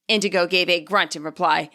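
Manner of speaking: ordinary delivery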